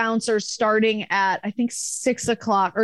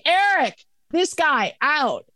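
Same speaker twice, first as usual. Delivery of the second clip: calling out; 7 semitones higher, slower than usual. Retold or imitated. Calling the bouncer.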